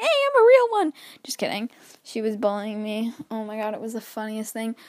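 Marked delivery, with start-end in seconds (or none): 0.0-0.9 imitating younger sister. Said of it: "She's like".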